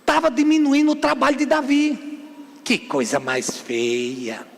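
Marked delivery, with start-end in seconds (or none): 2.6-4.5 very low, slow, soft tut-tutting; hushed, deep, drawn-out reproach; disapproving aside. Real, not acted. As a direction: draw it out low in heavy disapproval.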